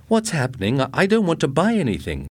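high, fast, flustered protest; stammering in alarm; panicky man. A narrator's reading. Ed panicked by the robot.

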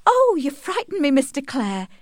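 high, loud startled exclamation; startled, nervous. A narrator's reading. Tess to Angel, startled on the stairs, nervously. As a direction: jump in fright, voice leaping high. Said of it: "she said with a nervous laugh".

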